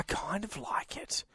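sheepish whisper. Real, not acted. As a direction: whisper it like a guilty secret. Admitting liking gross idea.

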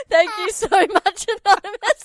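can barely talk for laughing. Real, not acted. Host laughing while thanking caller.

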